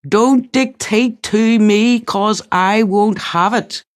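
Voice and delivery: gentle stepmother pretending to scold in Northern Irish, syllable by syllable; Northern Irish accent. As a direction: beat out each syllable in a Northern Irish accent, playing cross. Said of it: "in her Northern Irish accent".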